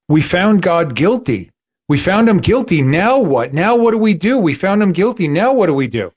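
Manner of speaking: imitating a desperate man, high-pitched and urgent; retold panic, voice swooping high, repeating in distress; near-panicked plea. Camp prisoner in the movie asking what to do now, near panic. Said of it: "in panic almost, he's, he's like, you know, crying".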